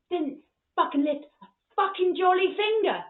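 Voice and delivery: loud, high, punched-out swearing; imitated father's loud indignation; indignant dad rant. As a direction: build to an outraged bark. Dad ranting, acted.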